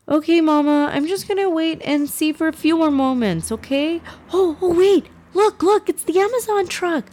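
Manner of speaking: put-on high, eager child voice; excited little boy. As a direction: bounce like a kid spotting a delivery. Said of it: "Yusuf voiced as child".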